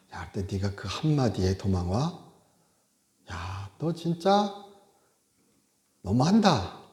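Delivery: a hint of hurt reproach; mock disappointment. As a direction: sigh it out like a let-down friend. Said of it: "이러실 수도 있잖아요". (실망한 듯 살짝 나무라는 말투)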